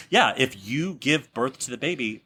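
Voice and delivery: ordinary talk, nothing marked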